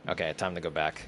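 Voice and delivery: usual speaking voice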